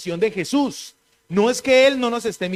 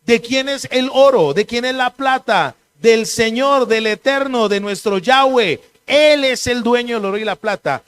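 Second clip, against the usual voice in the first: louder, forceful sermon delivery; preaching at full force; 3 semitones higher, 6 dB louder than usual. Acted. Pastor preaching to the congregation at full force. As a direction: thunder the declaration to the congregation.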